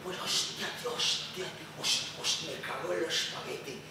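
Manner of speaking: low, muttering dad over the paper; father reading the news. Acted. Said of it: "Así como mi padre".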